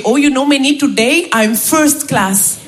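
slow, blissful Indian English; Indian accent. Retold or imitated. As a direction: stretch out first class in an Indian accent. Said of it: "il me dit". (Raj, accent indien, tout content)

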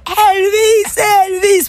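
high-pitched fan cry; mock crowd yell. Crowd drowning out the pope, high mock yell. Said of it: "et tout le monde".

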